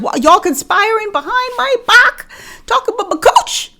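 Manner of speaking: high, loud indignant outburst. Acted. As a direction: act betrayed by everyone at work. Reacting to manager's odor talk.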